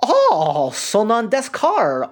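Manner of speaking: overdone foreigner's Japanese, drawn out; Kate's Japanese. Retold or imitated. Imitating how Kate talks all show, slow accented Japanese. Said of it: "that's how she talks the whole show".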